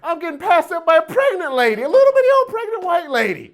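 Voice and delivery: high, loud and fast through laughter; laughing disbelief at losing to a pregnant lady; laughing outrage. Retold or imitated. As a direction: cackle it out, mock-outraged. Laughing at being overtaken.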